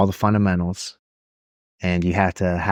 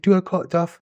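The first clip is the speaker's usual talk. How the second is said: much higher, panicky mock questions; much higher flustered mock voice; flustered kid; 9 semitones higher than usual. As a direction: play a lost young Marine at formation. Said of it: "he's like".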